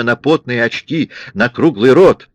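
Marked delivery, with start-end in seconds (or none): none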